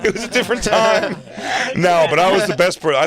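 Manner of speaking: loud, high, through real laughter; laughing echo. Real, not acted. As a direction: crack up while repeating his excuse. Laughing echo of the friend's excuse.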